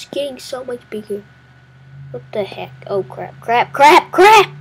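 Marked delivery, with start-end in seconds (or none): 3.4-4.5 rising into a high loud panic; frantic escalating cries; panicked yelling. Real, not acted. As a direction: build from alarm to a scream. About to be eaten.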